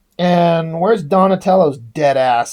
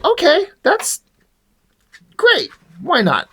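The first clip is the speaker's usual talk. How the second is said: mock enthusiasm; 9 semitones higher than usual. Sarcastic reaction to Larsen letter.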